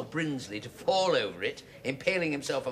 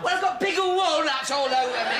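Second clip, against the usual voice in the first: high, loud indignant wail; loud protest; 10 semitones higher, 5 dB louder than usual. Acted. Blamed son covered in pickled walnuts, loud protest. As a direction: protest at the mess all over you.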